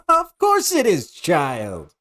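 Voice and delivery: high, gleeful taunt dropping on the last word; mock the hero like a child, pitched high; gleeful villain sneer; taunting villainess.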